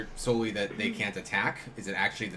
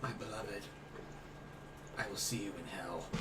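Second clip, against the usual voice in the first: cursing under breath; 12 dB quieter than usual. Acted. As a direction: mutter it so only he hears. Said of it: "I say under my breath so I don't embarrass myself".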